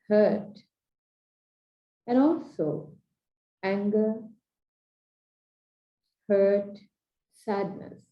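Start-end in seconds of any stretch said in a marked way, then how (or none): none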